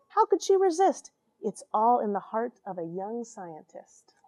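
lively read-aloud storytelling; expressive storybook voice; story narration. A narrator's reading. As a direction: read it like a bedtime picture book. Rhyming picture book read aloud.

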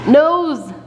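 "Nose!" called out high and bright; modeling the word. Shown as a demonstration. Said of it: "I want you to say nose".

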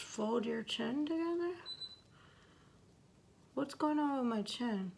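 lips covered by wet mask; through a sheet mask. Real, not acted. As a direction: hands pressing at mouth. Seen 2: Left speaker's sheet mask covers lips; hands press it at chin.

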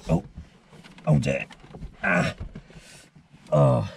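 strained groans climbing in. Real, not acted. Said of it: "Oh, I sound like an old man".

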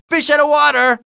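high-pitched called-out quip. Acted. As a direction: call it out high, like a wisecrack. Game hero's quip called out.

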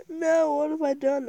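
high-pitched dismay at own mistake. Real, not acted. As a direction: wail it as if you just wrecked your work. Dismayed at ruining the skin.

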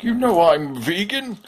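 a stuffed tiger's prim reminder; Tiger Blanket voice. Acted. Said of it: "He's like".

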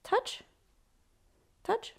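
high, soft repeated touch cue. Shown as a demonstration. Trainer cueing the dog in a high, soft voice. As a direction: chirp "touch, touch," up high and light. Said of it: "you can begin by movement".

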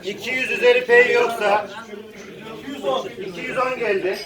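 calling out bids at auction; rhythmic auction calling; auctioneer. Real, not acted.

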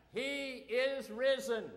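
loud, high and slow last proclamation; slow final call. Shown as a demonstration. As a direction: stretch it out for the final try. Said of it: "we'll try it one more time".

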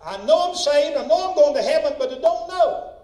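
loud, high-pitched pulpit insistence; emphatic preaching. Real, not acted. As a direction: drive it home to the congregation, loud and high. Preacher emphatic about assurance of heaven.

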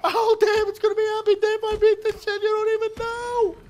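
giddy, laughing through the hype; high laughing excitement, fast. Real, not acted.

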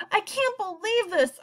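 an upset son crying out at his father. Acted. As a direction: wail it out in protest. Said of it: "I cried".

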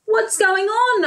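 high alarmed asking; alarmed question. Acted. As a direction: ask in worry. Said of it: "asked Aubergine".

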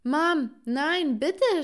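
high, loud desperate plea; pleading scream. A narrator's reading. Daughter being locked up by mother, pleading scream. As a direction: cry out and beg, voice climbing. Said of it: "schrie ich".

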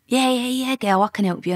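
imitated keen street bloke; mimicked eager geezer. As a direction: play a geezer readily agreeing to help. Retold geezer eagerly agreeing to help.

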